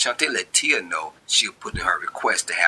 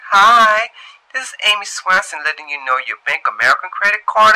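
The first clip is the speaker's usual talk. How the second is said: higher, louder, brisk recorded-message voice; collections voicemail; 10 semitones higher, 8 dB louder than usual. Acted. Bill collector leaving Shantae a voicemail, higher, louder and brisk.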